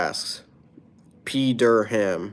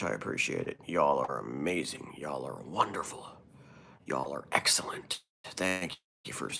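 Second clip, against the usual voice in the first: soft fading thanks; 8 semitones lower, 10 dB quieter than usual. Real, not acted. Thanks viewers, fading to whisper.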